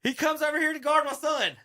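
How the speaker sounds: excited play call. Real, not acted. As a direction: call the key moment with rising excitement. Excitedly narrating his son's game video.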